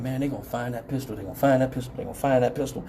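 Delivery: low, hushed worried muttering; retold nervous thought, soft and low; worried inner voice. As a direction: think it under your breath, anxiously repeating. Anxious thought during car search.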